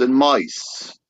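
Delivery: deliberate ending after a long pause; clearer Cockney repeat. Shown as a demonstration. Host finishes the repeat so everyone understands, deliberate delivery. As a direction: set the last words apart.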